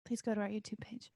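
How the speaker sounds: hushed unvoiced plea; whisper. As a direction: whisper it like a secret ad. Whispered plug.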